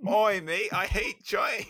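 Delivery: high, loud Australian whine; Aussie accent, Rocco complaining. Pretend. Asked by cohost, Australian accent Rocco whining. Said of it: "say it in an Australian accent".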